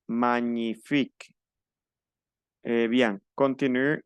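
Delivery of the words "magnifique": Magnifique, slow, gn as ñ, final e silent; drawn-out Magnifique like a French lesson. Shown as a demonstration. Teacher repeats Magnifique to the class, slow and careful. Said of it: "no está la G por ningún lado".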